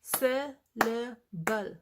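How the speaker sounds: "Syllable" in three separate beats, slow and loud. Shown as a demonstration. Teacher clapping out syllables for learners, syllable by syllable. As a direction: break "Syllable" into syl, la, ble with clear gaps. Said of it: "say the word aloud and clap with it".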